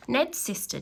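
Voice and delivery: ordinary delivery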